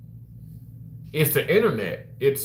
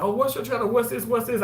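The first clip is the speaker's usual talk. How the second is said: higher-pitched, eager questioning; imitating nosy coworkers; 7 semitones higher than usual. Coworkers ask about the channel, imitated eager questioning. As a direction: mimic people pestering with questions.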